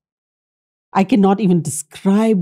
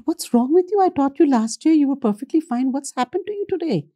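concerned question; 11 semitones higher than usual. Retold or imitated. Teacher questioning troubled former student.

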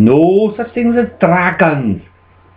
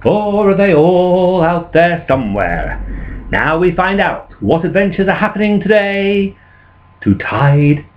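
storyteller's introduction; 3 semitones higher than usual. Acted. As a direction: draw the audience into the tale. Introduces the play.

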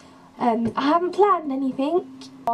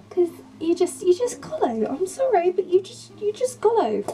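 excited; 8 semitones higher than usual. Real, not acted. Gushing about Disney films.

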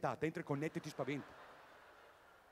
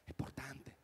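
breathy whisper of importante; whispered catchphrase; 8 dB quieter than usual. Real, not acted. The comic whispers his catchphrase to the audience. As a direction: whisper it confidentially.

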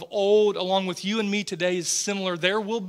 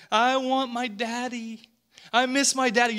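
higher, a light imitation of a tearful little girl; little daughter crying; 5 semitones higher than usual. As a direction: echo his small daughter's tearful plea. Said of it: "she just kept saying".

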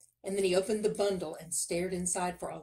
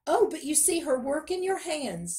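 high, loud, eager protest; pitched-up, pushy objection; protesting miller; 9 semitones higher, 6 dB louder than usual. A narrator's reading. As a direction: deflect the king's demand eagerly. Miller deflects the king's demand.

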